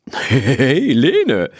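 helle, verspielte Ponystimme; wiehernde Ponystimme. A narrator's reading. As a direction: ruf sie wie ein frecher Pony. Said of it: "wiehert Tommy". (verschmitzte Ponystimme)

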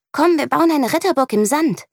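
put-on high, eager child's voice; girl asking her friend to build a sand castle, high and hopeful; little girl suggesting. A narrator's reading. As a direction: pitch up like a small girl with an idea. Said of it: "schlägt sie Lea vor".

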